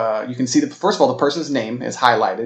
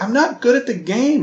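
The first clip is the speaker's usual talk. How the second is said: much higher hurt, defensive protest; a little defensive; 11 semitones higher than usual. Retold or imitated. Girlfriend defending herself after being yelled at, higher. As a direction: push back, stung by the criticism. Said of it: "she got a little defensive".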